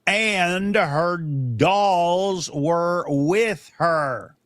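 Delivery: very slow, each word spelled out for the opponent; drawn-out emphatic reading. Real, not acted. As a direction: read it out dead slow so he can't miss it. Rereads the hadith to the stubborn opponent.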